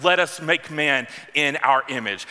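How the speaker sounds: ordinary talk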